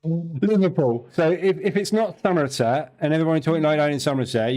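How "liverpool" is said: slow drawn-out Scouse Liverpool; Liverpool accent. Retold or imitated. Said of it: "you got people in Liverpool that talk like that".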